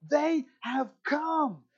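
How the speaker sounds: high, slow, stretched-out exclamation; joyful cry. Retold or imitated. Weak prisoners jumping for joy on the tables, exultant. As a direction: proclaim it jubilantly, each word held. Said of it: "jumping for joy and saying".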